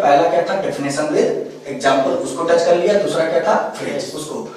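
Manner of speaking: normal talking voice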